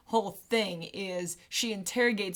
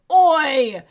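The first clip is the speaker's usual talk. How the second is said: loud Cockney Oi; Cockney shout; 5 semitones higher, 12 dB louder than usual. Shown as a demonstration. Co-host showing Ray Winstone's Cockney, a loud Cockney Oi. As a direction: bark a Cockney Oi.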